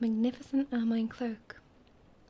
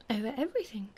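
king's simple, settled answer; plain, matter-of-fact; faster than usual. A narrator's reading. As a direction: state it plainly, falling at the end. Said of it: "replied the king plainly".

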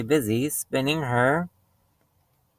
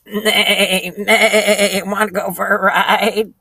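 higher, quavering horse whinny voice; whinny the words like a horse; talking horse with a wobbly neigh running through it; 7 semitones higher, 5 dB louder, slower than usual. Acted. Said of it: "asked the horse".

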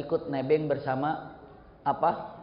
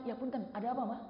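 high, hushed answer; polite soft reply; 6 semitones higher, 9 dB quieter, faster than usual. Acted. Child who ran to mother, answering softly.

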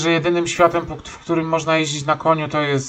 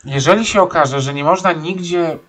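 usual speaking voice

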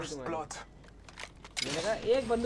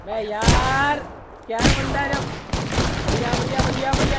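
loud excited cheering; excited shouting; 3 semitones higher, 7 dB louder than usual. Real, not acted. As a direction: cheer it loudly mid-fight. Intense fight, cheering.

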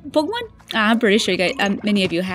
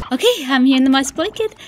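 ordinary talk, nothing marked

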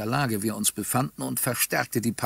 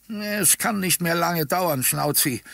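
put-on high, slow old man's voice; drawn-out, consoling old-timer; frail old man; 6 semitones higher, slower than usual. A narrator's reading. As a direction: comfort a dog as a frail old gentleman. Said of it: "tröstete ihn ein gebrechlicher alter Herr".

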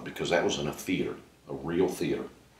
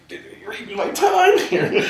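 high, louder, slower words through laughter; laughing complaint; 9 dB louder, slower than usual. Retold or imitated. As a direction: slowly protest while laughing. Comic complaint to audience.

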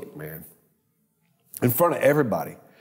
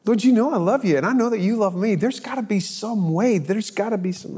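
higher, earnest retold plea; pleading prayer; 7 semitones higher than usual. David praying for the judgment to pass, pleading high. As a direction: beg God for another way.

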